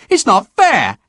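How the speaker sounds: high, whiny indignant cry; indignant shout. Acted. Marriott shouting at the clerk that it's not fair, high. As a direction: protest the unfairness. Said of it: "Marriott was shouting at the clerk".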